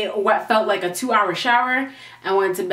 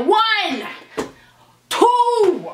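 Jamaican mother counting; 8 semitones higher than usual. Retold or imitated. Jamaican mother counting down at her late daughter, strict. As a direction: count like a mom about to whoop her kid. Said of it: "Like I was a Jamaican mother".

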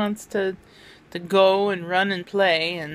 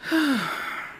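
sigh; 7 semitones higher than usual. Real, not acted. Tired sigh after hospital visit.

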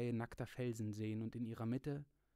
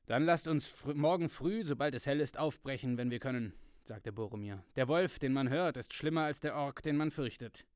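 louder, urgent warrior warning; proud soldier arguing louder than the rest; Boromir, forceful; 3 semitones higher, 8 dB louder than usual. A narrator's reading. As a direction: press the warning hard and loud. Boromir voiced in read story.

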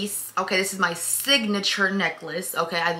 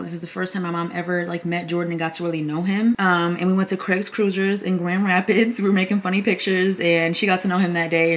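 ordinary talk

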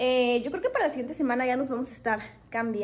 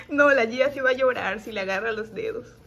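ordinary delivery

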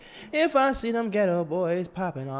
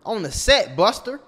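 high, louder hammed-up rant; mock angry shout; 8 semitones higher, 7 dB louder than usual. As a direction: yell like a hothead bragging. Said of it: "calm down".

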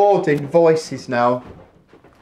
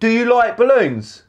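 calling out; 10 semitones higher than usual. Real, not acted. Calling question to Brad.

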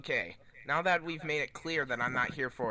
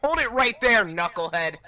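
shouted warning, louder and higher; 6 semitones higher, 7 dB louder than usual. Acted. Sonic to Knuckles, stopping him with a loud warning. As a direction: bark it out to stop him cold.

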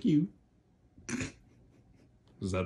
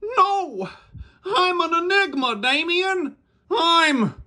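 much higher, louder cry; loud denial; 5 dB louder than usual. Acted. Puppet loudly insisting to Damien he is alive. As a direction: shout it grandly.

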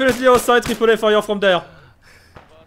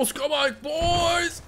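shouted call; 8 semitones higher, 8 dB quieter, much slower than usual. Acted. Rallying squad to move out.

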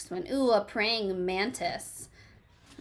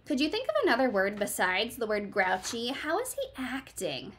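ordinary talk

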